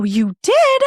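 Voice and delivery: high, loud, delighted surprise; surprised you did. Retold or imitated. Mom hearing her son was brave, surprised and high. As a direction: pitch it up like sudden good news.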